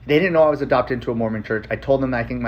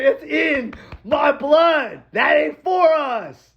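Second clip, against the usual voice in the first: much higher, slow, drawn-out yell of pride; proud shout; much slower than usual. Real, not acted. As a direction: shout it slowly like a battle cry. Proud shout about family.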